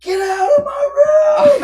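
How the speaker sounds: drawn-out, high mock scream; hammed-up, full-volume slow holler; retold yell. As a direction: imitate a gamer bellowing at family. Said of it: "will sit there and yell".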